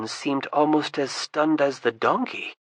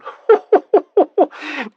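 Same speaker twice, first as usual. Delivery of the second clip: high, loud triumphant cackle; gloating laugh; 13 semitones higher, 9 dB louder than usual. Acted. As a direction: laugh at Tryon's coming fall.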